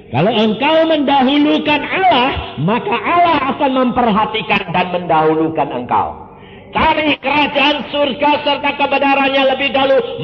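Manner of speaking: loud, high preaching proclamation; full-force preaching. Acted. Preacher exhorts the congregation, loud and fervent.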